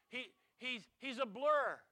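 ordinary delivery

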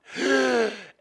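high, loud, desperate gasp; gasping up out of the water; loud gasp for air. Retold or imitated. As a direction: gulp for air like a half-drowned man. Drowning man surfaces.

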